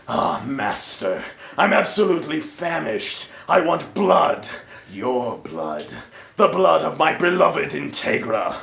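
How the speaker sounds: a low, amused vampire count; Alucard voice. Acted.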